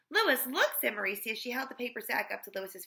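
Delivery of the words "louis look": high, loud eager call. A narrator's reading. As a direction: grab his attention. Mauricia calls for attention.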